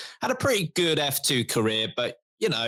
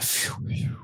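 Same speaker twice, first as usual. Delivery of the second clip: relieved sigh; 4 dB quieter than usual. Retold or imitated. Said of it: "can breathe a sigh of relief, like".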